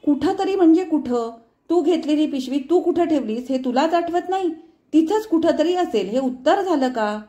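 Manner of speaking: high, loud furious questioning; enraged mother shouting. A narrator's reading. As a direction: yell in uncontrollable anger. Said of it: "अनावर संतापाने ओरडली".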